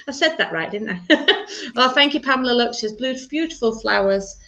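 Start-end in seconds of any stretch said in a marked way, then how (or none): none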